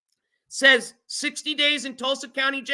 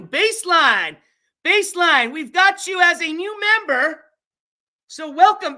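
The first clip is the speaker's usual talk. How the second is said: loud, raised-pitch welcome; excited shout-out; 5 semitones higher, 5 dB louder than usual. Real, not acted. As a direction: hail the new member excitedly. Announcing a new member to viewers.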